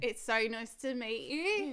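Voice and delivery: slow, lightly Aussie greeting; Australian accent. Pretend. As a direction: play the fake Australian girl. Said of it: "we'll reenact it".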